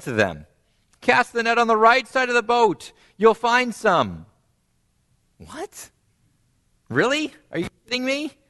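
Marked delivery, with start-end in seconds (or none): 1.0-4.3 confident stranger. Acted. Voices Jesus' command. 5.4-8.4 high disbelieving outburst; can't believe a stranger says this; incredulous. Acted. Disciples' incredulous reaction voiced.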